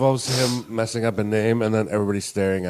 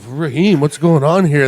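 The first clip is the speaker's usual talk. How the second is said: higher, louder bewildered blurting; loudly baffled, pitched up; mock loud confusion; 5 semitones higher, 8 dB louder, slower than usual. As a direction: imitate a friend puzzling out loud. Voicing Dom's loud confusion.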